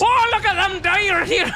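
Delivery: mock southern caster, breaking into laughter; southern accent. Caster keeps up a laughing southern accent for the Dire. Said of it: "I want that southern drawl".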